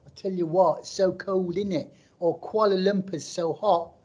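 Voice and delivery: mock a Malaysian friend putting on British airs; showy put-on London accent. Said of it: "They come back like".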